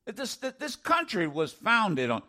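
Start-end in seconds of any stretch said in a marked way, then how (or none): none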